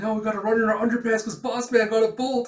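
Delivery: fast, high, loud fretting; mock panic. Flunkies dreading underpants punishment, mock panic. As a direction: rattle it off in hammed-up alarm.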